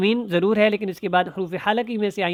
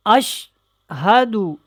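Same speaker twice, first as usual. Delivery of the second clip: slow, drawn-out recitation with clear letters; careful tajweed recitation; 3 semitones higher, 4 dB louder, much slower than usual. Shown as a demonstration. Teacher demonstrating the kalima to learners, slow tajweed recitation. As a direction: recite slowly, stretching each word. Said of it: "بہت دھیان سے اس کو سیکھیں".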